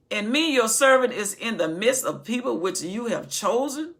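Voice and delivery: loud sermon reading; booming pulpit reading; preaching scripture. Acted. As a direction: proclaim the verse. Reading scripture at full force.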